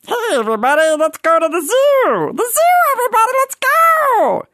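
very high, squealing excited cartoon voice; Meatwad, super happy. Cartoon character rallying everyone for a zoo trip, super happy. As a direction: shout it out high and giddy, stretching the last words. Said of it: "he shifts from super happy to testy".

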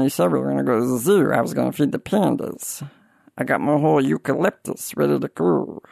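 dejected cartoon grumble in a put-on voice; Meatwad, testy. Cartoon character protesting the cancelled zoo trip, testy. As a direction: sulk and slur it under the breath, a little testy. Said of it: "he gets a little testy".

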